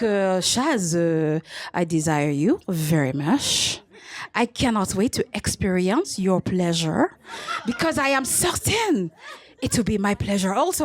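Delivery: put-on low, slow, lightly French-accented seducer; French lover. Comic voicing the French match's text, low light French accent. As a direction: purr it like a smooth Frenchman, lightly. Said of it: "He's like".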